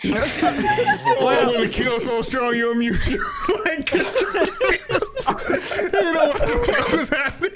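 high, laughing and slowed; cackling through the words; panicking gamer. Real, not acted. Laughing at an unmuted kill.

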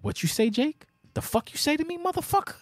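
hammed-up indignant comeback; mock outrage. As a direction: play the offended boss, pitch shooting up. Acted angry reply to Jake.

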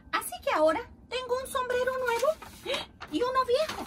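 high, put-on character voice; storybook uncle. Uncle takes stock of two hats, high animated voice.

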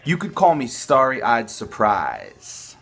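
normal talking voice

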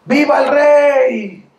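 high, slow, hammed-up cheer for the king; mock adoring shout. Tailor calling out to the king from upstairs, high and slow. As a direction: drag it out like a fawning heckler.

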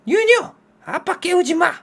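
put-on high, loud yell at the child; wife yelling. Wife yelling at child not to wake dad, loud high shout.

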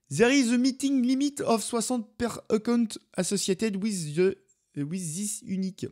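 mock French accent reading English; exaggerated French accent. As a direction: lay the French accent on thick on purpose. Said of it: "j'ai un peu fait exprès, l'accent français".

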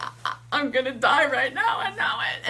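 high, loud stammered mock panic; freaking out. Sure the woman will attack, mock freak-out. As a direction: stammer it out, pitch climbing. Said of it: "I'm freaking out".

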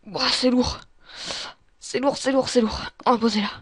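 loud, effortful voice under a load; straining. Real, not acted. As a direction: call it out while heaving something heavy. Straining with a heavy object. (voix forte, en plein effort)

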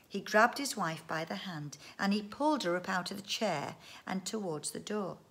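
plain reading voice

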